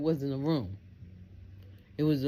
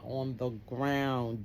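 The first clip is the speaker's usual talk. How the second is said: ordinary talk, nothing marked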